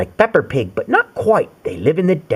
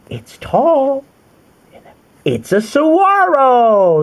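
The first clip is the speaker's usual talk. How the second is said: high, loud slow saguaro; bright excited reveal; cheerful answer; 11 semitones higher, 4 dB louder, slower than usual. Acted. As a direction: answer proudly like a child. Answering for child.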